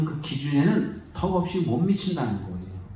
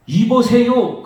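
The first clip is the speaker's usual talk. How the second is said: loud, higher rude "이보세요!" with a falling end; rude shout; 6 semitones higher, 6 dB louder, slower than usual. Retold or imitated. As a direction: bark "이보세요!" at the father. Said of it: "그러는 거예요".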